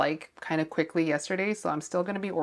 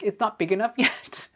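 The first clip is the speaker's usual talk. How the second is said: laughing; 5 semitones higher, 4 dB louder than usual. Retold or imitated. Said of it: "I'm like".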